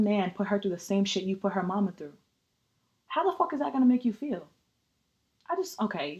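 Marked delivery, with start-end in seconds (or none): 3.1-4.4 angry. Real, not acted. Angry rant about [name].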